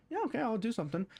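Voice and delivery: lightly voiced, willing reply; casually willing. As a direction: agree readily, not powerless. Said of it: "No, he was like".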